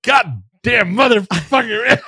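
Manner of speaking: slow drawn-out loud curse; Steve Austin cursing. Retold or imitated. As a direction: stretch the curse out loud and high. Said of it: "He couldn't even get the lines out".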